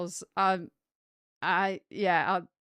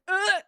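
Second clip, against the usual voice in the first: high, loud disgusted ugh; 10 semitones higher, 5 dB louder than usual. Retold or imitated. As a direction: squeamish groan of disgust. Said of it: "everybody that just went like".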